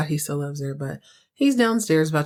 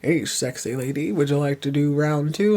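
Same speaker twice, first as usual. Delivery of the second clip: lower, a lightly voiced sleazy come-on with a laugh; mock seductive, eyebrows up; sleazy flirt; 4 semitones lower than usual. Jeff hitting on Fallon in the kitchen, lightly mock sleazy. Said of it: "Jeff is wiggling his eyebrows like".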